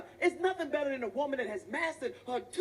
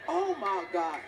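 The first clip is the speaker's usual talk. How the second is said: ordinary delivery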